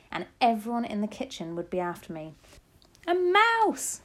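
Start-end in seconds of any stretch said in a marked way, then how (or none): 3.1-4.0 high, loud cry of alarm; shouting. A narrator's reading. Kitchen staff after a falling mouse, shouting. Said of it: "they were shouting".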